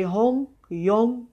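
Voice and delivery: lost in blissful reverie; lilting, each sound set apart; dreamy. A narrator's reading. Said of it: "she murmured dreamily".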